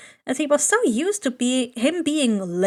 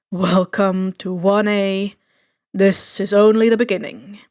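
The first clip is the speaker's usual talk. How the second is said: tired; 5 semitones lower, 4 dB louder than usual. A narrator's reading. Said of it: "Eraser tiredly say over the group".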